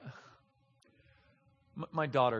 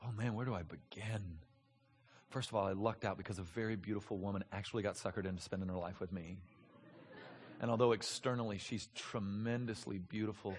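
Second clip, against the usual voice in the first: softer, lower, gentle talk about his wife; tender husband; 6 semitones lower, 7 dB quieter than usual. Pretend. Husband telling a friend about his wife, tenderly. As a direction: speak of her lovingly and quietly.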